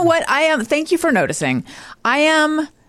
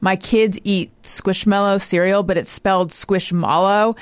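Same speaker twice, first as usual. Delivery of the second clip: ordinary delivery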